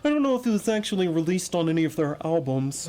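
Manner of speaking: put-on hipster nerd voice; mock a know-it-all music nerd; mocking nerdy voice. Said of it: "that's my mocking, nerdy voice".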